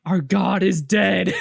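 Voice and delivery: loud, slow wail of doom, played for laughs; hammed-up despair of a doomed crowd; mock panic. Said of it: "the ant civilization just goes into panic".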